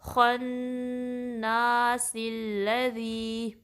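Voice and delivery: slow, drawn-out Arabic recitation of खन्नासिल्लज़ी; Quran recitation demo. Shown as a demonstration. Teacher to students, demonstrating tajweed recitation. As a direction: stretch खन्नासिल्लज़ी slowly with tajweed. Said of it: "तो आप इस तरीके से पढ़ेंगे".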